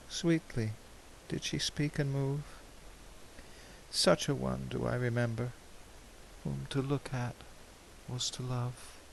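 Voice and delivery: quiet wistful recollection; tender memory. Acted. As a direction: hush down into a fond memory. Tender memory of a lost love.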